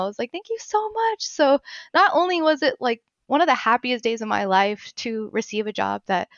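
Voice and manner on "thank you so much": high-pitched, overcome with gratitude; gushing, touched thank-you; retold emotional thanks. Thanking the server for free dessert, emotional. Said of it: "I was just so emotional".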